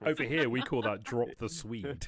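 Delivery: a big loud punchline; loud joking boast. Real, not acted. To the table after the headbutt joke, a loud joking boast. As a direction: shout the joke out with a grin.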